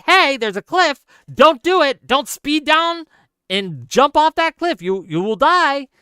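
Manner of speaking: loud high shout warning them off the cliff. Retold or imitated. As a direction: yell it like someone's about to go over a cliff. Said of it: "I'm shouting".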